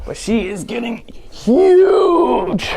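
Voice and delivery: thrilled, drawn out and loud; excited. Real, not acted. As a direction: gush over the puppy. Showing off the new puppy.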